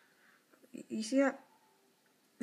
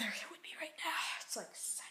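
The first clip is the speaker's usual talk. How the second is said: whispering; 7 semitones higher, 8 dB quieter, faster than usual. Real, not acted.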